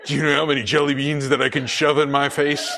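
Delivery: put-on giggly childish glee; laughing greedy kid. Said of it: "kid excited, laughing".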